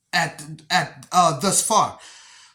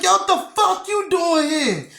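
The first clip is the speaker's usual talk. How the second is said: retold shout; 10 semitones higher than usual. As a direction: shout it at her in disbelief. Shouting question at sister.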